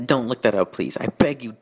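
ordinary talk, nothing marked

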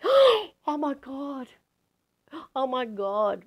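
high, loud burst of amazement; astonished. Retold or imitated. Speaker relives realising her family never knew yoga, astonished. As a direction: re-enact a stunned realisation, starting very high.

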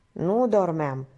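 plain reading voice

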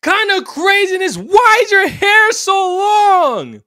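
high, loud and slow, in disbelief; outraged shout. Real, not acted. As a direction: shout your outrage slowly, stretching each word. Outraged at the bodycam footage.

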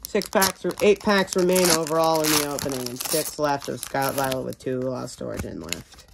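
ordinary talk, nothing marked

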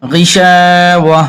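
غشاوة drawn out slowly and melodically; slow recitation. Shown as a demonstration. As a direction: hold each syllable of غشاوة. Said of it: "আর এখান থেকে মিলিয়েও যাওয়া যাবে". (ধীরে টেনে পড়া غشاوة)

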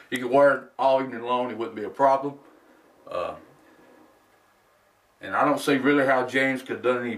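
muffled behind full mask; speaking inside rubber mask; through a face mask. Real, not acted. Seen 2: Full over-the-head mask worn on face throughout; removed in references.

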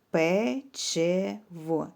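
печиво very slowly as пе-чи-во; three separate beats in печиво; syllable by syllable. Shown as a demonstration. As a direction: sound out печиво, holding each syllable. Teacher splits word into syllables.

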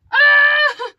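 high, loud "ah" scream; sudden scream of surprise; startled scream. Real, not acted. Unboxer as the contents fall out, startled scream.

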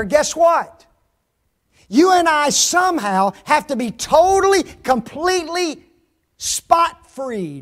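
high, loud preaching with heavy emphasis. Real, not acted. Preacher describing heaven's purity to folks, full-force preaching. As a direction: proclaim each word with force.

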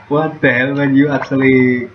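loud, high, exasperated; indignant outburst. Real, not acted. Gamer yelling at a character who betrayed him. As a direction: shout at the screen in disbelief.

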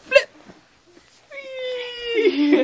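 very high, joyful squeal; playful cheer mid-flip; gleeful whee. Real, not acted. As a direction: squeal with delight. Flipping toddler, cheering.

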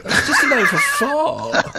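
giggling that it means fart; laughing realisation. Retold or imitated. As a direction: let the joke crack you up as you say it. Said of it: "And I was like".

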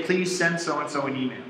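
soft, polite full sentence; polite request. Shown as a demonstration. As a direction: say it nicely, like asking an assistant. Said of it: "You'd say".

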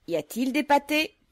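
loud, high ringing call; booming voice. Acted. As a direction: ring it out across the yard. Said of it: "d'une voix retentissante".

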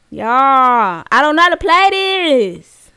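loud, high helpless wail; panicky whine. Real, not acted. Scared player lost as game starts, panicky wail. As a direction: wail it to your viewers in dismay.

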